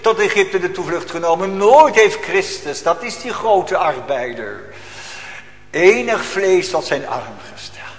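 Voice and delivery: loud preaching, pressing the congregation; preacher warning the congregation about sin, loud and urgent; fiery preaching. Acted. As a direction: thunder it from the pulpit. Preaching at full force.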